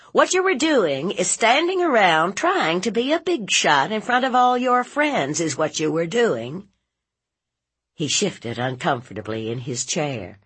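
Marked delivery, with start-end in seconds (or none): 0.1-6.7 high, loud indignant telling-off; scolding aunt. Acted. Aunt scolding nephew for showing off, indignant. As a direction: lay into him, pitched high.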